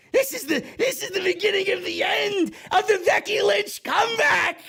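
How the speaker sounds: mocking a wrestler's crazy high-pitched promo; crazy maniac voice. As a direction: play her as a raving maniac. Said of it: "She gets crazy".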